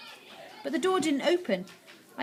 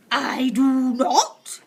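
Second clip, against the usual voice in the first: very slow, louder witch shout; 3 semitones higher, 8 dB louder, much slower than usual. A narrator's reading. Witch shouting at grandmother, slow and loud. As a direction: hammer out each word. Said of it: "shouted the Grand High Witch".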